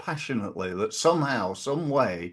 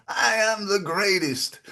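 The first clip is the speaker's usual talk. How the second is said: a slow, much higher boxer's proud chant; Muhammad Ali boast; 9 semitones higher, slower than usual. Retold or imitated. Podcast host imitating a boxer boasting to the world, slow high proud chant. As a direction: ham up the champion's brag, slow and high. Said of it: "He used to say".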